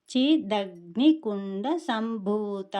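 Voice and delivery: చిదగ్ని కుండ సంభూత very slowly, each syllable clear; slow full recitation. Shown as a demonstration. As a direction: recite the whole name slowly. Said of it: "ఇప్పుడు మొత్తము చూస్తే".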